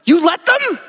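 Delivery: shocked he allowed it; disbelief. Retold or imitated. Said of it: "I'm like".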